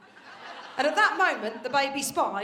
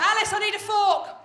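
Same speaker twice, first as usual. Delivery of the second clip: loud, higher-pitched comic call; 5 semitones higher, 8 dB louder than usual. Acted. Mock loud call from kitchen.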